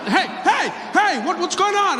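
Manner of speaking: a spooked officer yelling hey; startled cop shouting. Retold or imitated.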